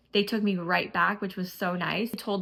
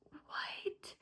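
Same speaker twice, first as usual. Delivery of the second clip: shocked whisper; 16 dB quieter than usual. Retold or imitated. Husband hears wife needs surgery.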